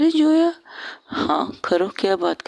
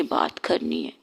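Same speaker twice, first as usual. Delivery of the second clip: whisper; 7 dB quieter than usual. Acted. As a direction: drop to a breathy whisper. Drunk insisting on talking. (फुसफुसाकर)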